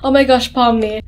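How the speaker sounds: ordinary talk, nothing marked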